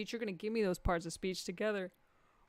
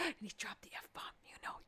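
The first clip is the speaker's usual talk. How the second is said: mock scandalised whisper; 13 dB quieter than usual. Real, not acted. Comedian whispering to the crowd about an audience member, mock shocked. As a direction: gasp then whisper it. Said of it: "gasps, whispered".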